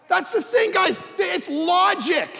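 high, loud, emphatic; preacher pressing the logic; preaching at full force. Real, not acted. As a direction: drive it home. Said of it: "preacher driving point".

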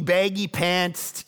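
ordinary delivery

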